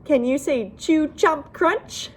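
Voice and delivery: ordinary delivery, nothing marked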